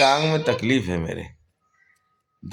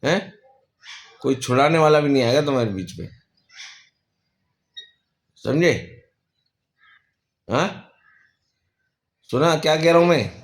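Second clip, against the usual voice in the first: mock threat; 3 semitones higher, faster than usual. Real, not acted.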